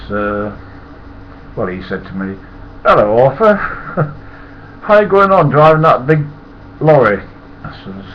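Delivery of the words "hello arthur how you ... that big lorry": higher, louder cheerful greeting; cheery window cleaner. Retold or imitated. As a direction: greet him brightly and loudly. Voicing Ernie's cheerful greeting.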